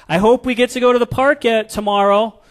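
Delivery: put-on a hopeful little kid begging; little kid's voice. Little kid hoping mom will take them to the park, high wishful child voice. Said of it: "a little kid says".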